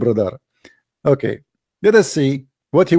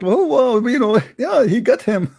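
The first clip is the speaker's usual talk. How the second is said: imitating gloating fans, higher, laughing; mock excited fans; 6 semitones higher than usual. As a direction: mimic the fans' giddy cheer, chuckling through it. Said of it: "saying like".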